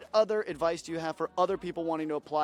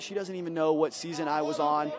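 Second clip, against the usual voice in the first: usual speaking voice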